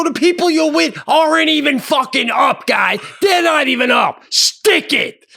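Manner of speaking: high, loud rant with punched ending; furious shouting. Retold or imitated. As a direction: shout them down before storming off. Acted screaming match, storming off.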